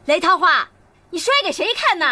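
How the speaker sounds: high, loud scolding; angry shout. Acted. Mother confronts someone for slamming things, angry shout. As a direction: shout the accusation at full pitch.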